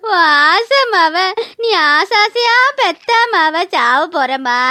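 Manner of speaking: very slow sing-song lament; tearful keening. Acted. Dying daughter calls her absent mother, slow wail. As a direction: wail for your mother like a lost child.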